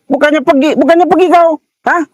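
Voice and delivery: very high exasperated yell; angry yelling. Real, not acted. Driver to a man who won't leave, very high angry yell.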